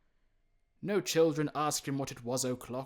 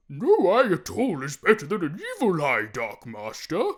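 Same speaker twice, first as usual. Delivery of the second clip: loud, high, strange voice, slowing on Dark Master; a loud, eerie warning voice; dogs' warning; 4 semitones higher, 12 dB louder than usual. A narrator's reading. The blind men's dogs warn Scrooge, loud and eerie.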